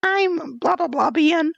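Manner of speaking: very high, squeaky mocking voice; sky-high sarcastic imitation of the girls; mocking girly voice. Mimicking girls listing identities.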